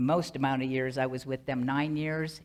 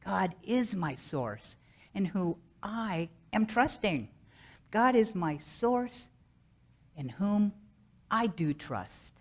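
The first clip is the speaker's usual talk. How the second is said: slow mantra, leaning on "is", "I" and "source"; emphasis on different words; 4 semitones higher, slower than usual. Shown as a demonstration. Speaker showing how to vary the mantra, slow stress on "is", "I", "source". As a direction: set "is", "I" and "source" apart with stress. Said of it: "I would emphasize different words".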